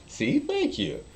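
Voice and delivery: very high mock coy thanks; hammed-up pleased reply; smug thanks. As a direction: take the compliment with a wink.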